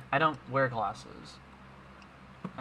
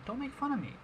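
high, whiny mock plea; mock whine; 11 semitones higher, 4 dB quieter than usual. Podcast host mock-pleads with friends not to tease him, high whine. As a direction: play the wounded victim, pitched way up. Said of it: "I just stuttered 'cause I have a speech impediment".

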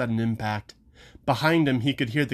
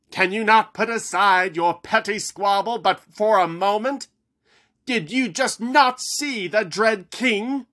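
impassioned plea; 8 semitones higher than usual. Acted. Haggerty pleading with both sides.

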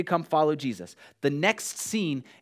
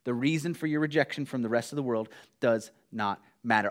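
ordinary talk

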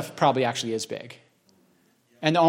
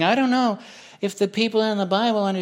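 mock freaking out; 7 semitones higher than usual. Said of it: "freaking out about it, going".

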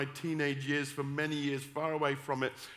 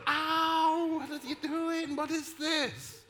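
very high, stretched-out squeal; hammed-up high cry of protest; mock pained yelp; 12 semitones higher, slower than usual. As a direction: yelp in comic outrage, far above your usual pitch. Retells yelping as she squeezed him.

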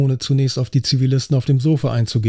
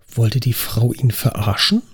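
ordinary delivery

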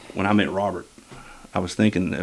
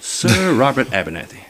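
put-on English accent for a formal announcement; 2 semitones higher than usual. Shown as a demonstration. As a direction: model a mock English lady's announcement. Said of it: "in your best English voice".

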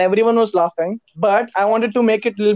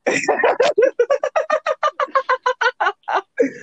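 long high-pitched laugh. Real, not acted.

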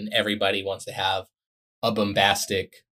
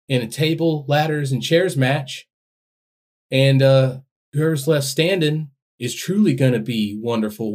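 soft-spoken wrestling promo; 4 semitones higher than usual. Pretend. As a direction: call out the crowd gently, wrestler style. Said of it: "give your best wrestling promo as [name]".

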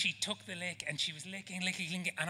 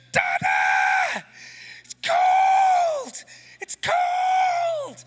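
put-on very high, piercing child's wail, stretched out; full-volume imitation of a toddler's scream; 13 dB louder, much slower than usual. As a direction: shriek it like a shocked little girl. Toddler screaming after biting ice cream.

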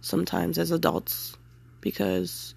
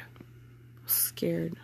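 slow, shaky whisper; 2 semitones lower, 15 dB quieter, much slower than usual. Real, not acted. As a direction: breathe it out barely voiced.

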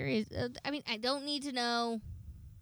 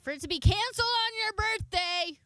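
indignant outburst; 11 semitones higher, 6 dB louder than usual. Real, not acted. Dramatic outrage at canceled concert.

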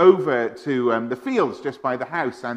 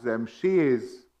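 ordinary talk

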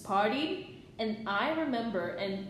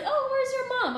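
much higher, sing-song politeness; sweet polite voice. Retold or imitated. Greeting his mom, high and sweet. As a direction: put on the polite girlfriend voice. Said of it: "and then I was like".

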